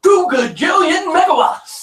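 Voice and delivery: high, loud mock radio hype; hyped announcer. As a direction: shout it like a cheesy ad.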